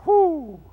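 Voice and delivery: high, loud "whoo"; exultant pulpit whoop; preacher's whoop. Real, not acted. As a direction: let out a brief holler. Preacher whoops before last point.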